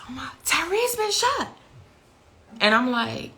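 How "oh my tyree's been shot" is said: much higher, retold panic of the caller; retold screaming. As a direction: mimic the panicked scream on the phone. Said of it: "It was screaming and hollering".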